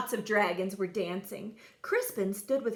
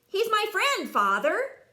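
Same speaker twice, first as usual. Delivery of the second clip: ordinary delivery, nothing marked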